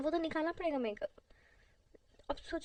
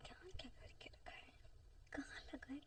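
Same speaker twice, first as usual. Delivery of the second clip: whisper; 16 dB quieter than usual. Acted.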